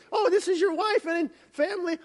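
bubbly leader meeting the family; chatty president. Retold or imitated. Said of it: "he's, like, all chatty".

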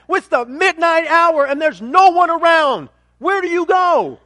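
high, loud, urgent preaching; impassioned preaching. Real, not acted. Preacher challenges the congregation on where to go, impassioned. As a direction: press the questions on them at full force.

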